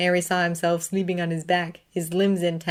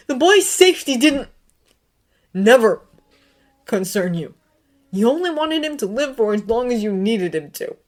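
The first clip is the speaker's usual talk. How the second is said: much higher and louder, shouting; furious shout; 6 semitones higher, 12 dB louder than usual. A narrator's reading. Severus screaming at Dumbledore to save Harry. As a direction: scream accusations at him.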